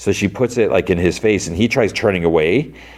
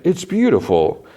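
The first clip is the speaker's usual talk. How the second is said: high-pitched mock wonder; imitated awed dad; 10 semitones higher than usual. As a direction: lightly act out a dad marvelling at his baby. Dad admiring the newborn baby.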